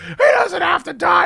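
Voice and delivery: high, loud, slow mock wail; imitating an irate girlfriend, drawn-out shout; retold furious shout. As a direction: protest in a hammed-up high yell. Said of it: "She's rip shit".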